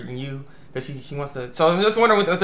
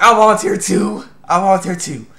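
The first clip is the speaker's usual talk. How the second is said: louder, higher, eager volunteering; 4 semitones higher, 7 dB louder than usual. Retold or imitated. Classmate eagerly volunteering too, loud. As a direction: shout your hand up, keen. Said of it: "it's like".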